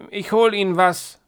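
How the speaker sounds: put-on high, loud little old lady; old woman voice. A narrator's reading. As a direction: play a nervous old lady. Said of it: "sagt die Frau".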